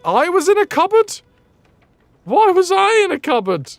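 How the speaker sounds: high, loud, baffled questioning; incredulous outcry. Real, not acted. Streamer learning they were in a cupboard, incredulous outcry. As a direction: voice leaps up in disbelief. Incredulous reaction to game revelation.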